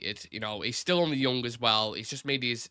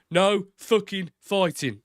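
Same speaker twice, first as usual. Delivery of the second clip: slow, loud Brummie growl, pitched up; fiery Brummie; 7 semitones higher, 6 dB louder, slower than usual. Shown as a demonstration. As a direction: hammer it home like Thomas Shelby. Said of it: "There's your Brummie fire".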